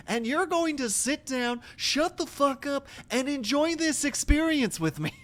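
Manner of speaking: usual speaking voice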